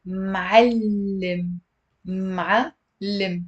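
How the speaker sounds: "Ma'allim" drawn out, then split m'al, lim; deliberate two-beat "Ma'allim" for learners; two syllables. Shown as a demonstration. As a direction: say "Ma'allim" slowly, holding the double l. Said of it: "it's also two syllables".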